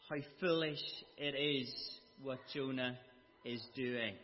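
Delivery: normal talking voice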